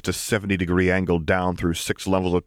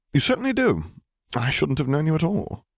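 Piet; 9 semitones higher than usual. Acted. Piet voiced.

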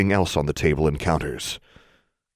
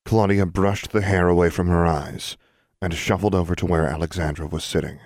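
ordinary delivery

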